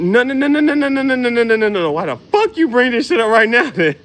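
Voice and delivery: rapid, loud, high no no no; loud high-pitched frantic refusal; panicked refusal. Acted.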